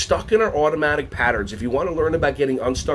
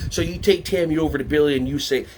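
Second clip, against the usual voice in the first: usual speaking voice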